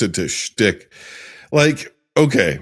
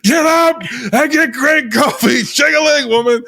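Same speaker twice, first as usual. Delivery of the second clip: high, hammed-up yelling as the grumpy dad; imitating a dad barking orders, pitched way up; mock screaming; 13 semitones higher than usual. Retelling the RV scene, mimicking the dad's high mock shout. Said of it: "he starts screaming".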